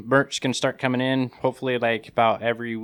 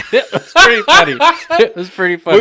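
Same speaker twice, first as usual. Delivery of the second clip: high laughing through the words; giggling as he says it; 12 semitones higher, 13 dB louder than usual. Real, not acted. Laughing while retelling.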